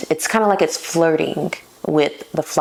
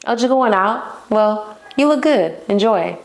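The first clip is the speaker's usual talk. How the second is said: breezy flirt; 5 semitones higher than usual. Shown as a demonstration. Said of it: "he's like".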